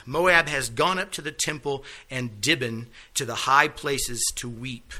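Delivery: loud, ringing scripture reading. Acted. Teacher reads Isaiah aloud to the class, loud proclaiming. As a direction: proclaim the verse loudly to the class.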